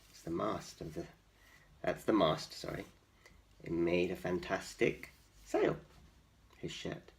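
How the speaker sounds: usual speaking voice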